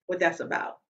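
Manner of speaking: ordinary talk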